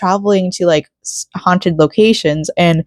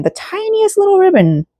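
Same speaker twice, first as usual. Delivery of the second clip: much higher, cutesy squeak on tiniest; tiny squeaky voice; 14 semitones higher than usual. Real, not acted. Gushing to viewers about a tiny ribbon, cutesy squeak. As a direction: squeal it like something adorable.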